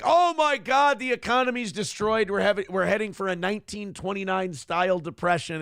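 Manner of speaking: hammed-up doom-saying from the left; mock panic. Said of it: "the left is like".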